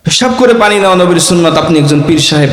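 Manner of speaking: ordinary delivery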